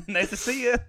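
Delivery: high, laughing, mock-polite goodbye; mock farewell. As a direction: wave him off sweetly while laughing. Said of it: "I'm like".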